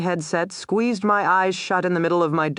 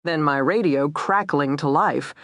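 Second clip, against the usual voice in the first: ordinary delivery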